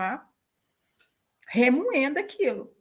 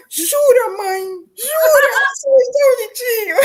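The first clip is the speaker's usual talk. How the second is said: voz de criança aguda e alta, encantada; imitando a filha criança; 12 semitones higher, 11 dB louder than usual. Retold or imitated. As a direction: faz a menininha surpresa, bem aguda e alta. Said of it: "Você fez assim". (voz infantil aguda e alta, cheia de espanto)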